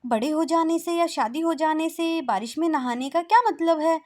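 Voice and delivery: put-on high, loud girlish plea; a daughter begging her mother; pleading girl.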